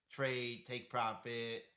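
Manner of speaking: ordinary talk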